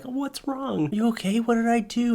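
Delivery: high, alarmed, worried questions; mock panic over his crying wife; retold worried husband. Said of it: "the first time I was like".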